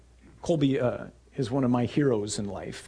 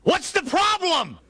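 high, drawn-out holler; yelling back; 3 dB louder than usual. Acted. Jesus yelling back to the panicked men, loud holler. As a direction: shout it over a roaring wind. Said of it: "he has to yell back".

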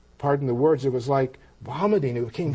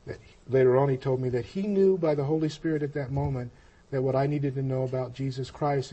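normal talking voice

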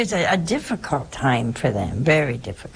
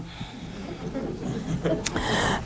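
soft laugh; 4 semitones higher, 8 dB quieter than usual. Real, not acted. Laughing at the uncle's tears.

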